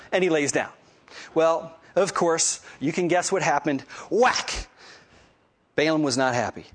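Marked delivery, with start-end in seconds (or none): 4.1-4.7 sudden high exclamation; emphatic whack. Retold or imitated. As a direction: punch it out like a blow landing. Acting out the donkey being hit.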